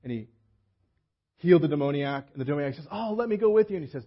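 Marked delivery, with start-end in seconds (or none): 2.9-3.8 eager plea. Retold or imitated. Healed man begs to follow.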